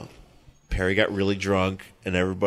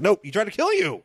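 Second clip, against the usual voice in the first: much higher mock voice; high-pitched; 12 semitones higher than usual. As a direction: squeak the line up high.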